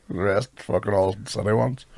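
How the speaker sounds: ordinary talk, nothing marked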